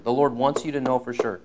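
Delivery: ordinary delivery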